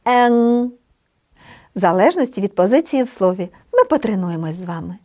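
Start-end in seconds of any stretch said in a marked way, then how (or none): 0.0-0.7 "Ен" said as a nasal Polish e; nasal e demo. Shown as a demonstration. Teacher showing the nasal letter to students, clearly. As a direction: model the nasal vowel "Ен" clearly. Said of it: "Вимовляється іноді чітко".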